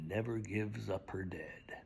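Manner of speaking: hushed, low whisper; whispered refrain. Acted. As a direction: drop it to a whisper.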